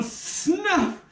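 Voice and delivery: high, stunned echo of the word; can't believe the offer; appalled disbelief; incredulous outrage. Acted. Noseless man offered snuff.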